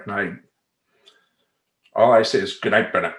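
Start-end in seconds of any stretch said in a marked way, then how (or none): 2.6-3.1 firm, low goodnight; stern dad voice. Retold or imitated. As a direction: set the boundary like a strict father. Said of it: "in my stern dad voice".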